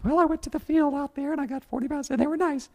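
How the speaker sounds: Ruth's voice. Acted. Preacher acting out Ruth answering Naomi, high and innocent. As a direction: play young Ruth, innocent and light. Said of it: "And Ruth says".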